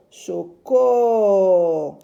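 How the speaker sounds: "Choco" loud, with a long held "co"; syllabe allongée. Shown as a demonstration. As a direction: linger loudly on the end of "Choco". Teacher sounds out syllables. (« Choco » fort, en allongeant le « co »)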